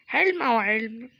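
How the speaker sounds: hammed-up announcement; mock dramatic call. Mock spooky exclamation. (إعلان مسرحي مصطنع)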